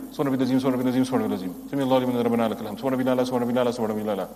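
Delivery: gabbled, very fast prayer; rushed prayer. Shown as a demonstration. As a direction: race through the words without meaning. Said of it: "That's what we're doing".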